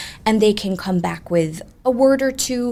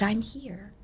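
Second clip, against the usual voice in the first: faint, soft ghostly voice; 10 dB quieter than usual. Retold or imitated. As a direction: barely breathe the words. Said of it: "very, very soft".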